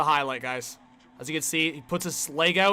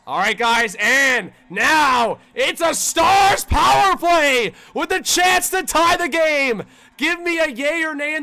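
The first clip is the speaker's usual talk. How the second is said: loud, drawn-out hype call; rising excited announcer shout; hyped announcement; 8 semitones higher, 6 dB louder, slower than usual. Real, not acted. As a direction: build up the big power play for the crowd. Hyping a power play chance.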